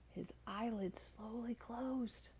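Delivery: slow and soft, eyes drooping shut; sleepy drowsy narration. A narrator's reading. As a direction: let the words drift off as if falling asleep. Narrating falling asleep.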